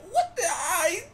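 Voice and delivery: high, slow hammed-up sob; mock crying. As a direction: wail it like a regretful guy. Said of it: "he be like".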